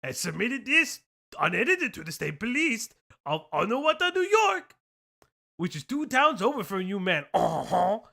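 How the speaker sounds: loud, indignant mock French; French accent. Reads insults from a post in a loud French accent. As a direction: rant in a hammed-up French accent. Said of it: "my French accent".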